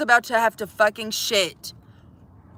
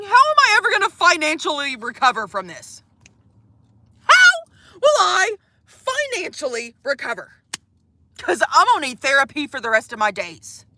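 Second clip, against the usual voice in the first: fast, higher, whiny near-crying; mock despair; 4 semitones higher than usual. Streamer retelling panic over the spilled piss, near-tearful mock despair. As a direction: wail like it's financial ruin, with a crack of a sob on the second ask. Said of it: "I was ready to fucking sob".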